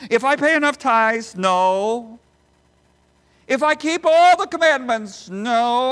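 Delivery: preaching at full force. Acted. Preacher to congregation, full-force rhetorical preaching. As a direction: raise the hall with each answer.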